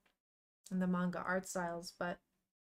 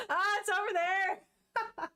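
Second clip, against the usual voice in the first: high, loud-ish tearful wail; overcome with emotion; 9 semitones higher, 4 dB louder, slower than usual. Real, not acted. Streamer unable to look at the touching scene, tearful wail. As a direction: cry it out slowly between laughs. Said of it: "it's so emotional".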